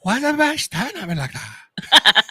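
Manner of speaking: mock-bewildered man just waking from a coma; confused coma patient. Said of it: "and he's like".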